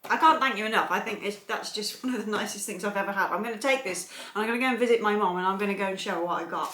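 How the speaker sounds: ordinary delivery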